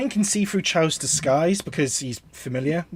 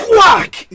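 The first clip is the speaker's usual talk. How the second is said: sudden high, loud WHACK sound effect; explosive high-pitched whack; loud imitated whack; 11 dB louder than usual. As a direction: shout a big crash noise. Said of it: "with a massive sort of".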